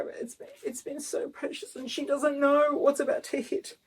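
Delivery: barely voiced, almost breaking into tears; the voice gives out under the tears; near tears. Real, not acted. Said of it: "Oh, I'm trying not to cry".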